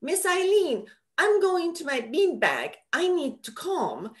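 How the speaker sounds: put-on high, earnest little kid voice; a preschooler's high, sincere voice; child's voice. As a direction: play a four-year-old announcing his plan. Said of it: "they're like ... goes".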